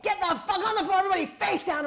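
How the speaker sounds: high, loud barked order; gunman's shout. Retold or imitated. Gunman bursting in shouting at the room. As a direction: yell it like an armed man storming in.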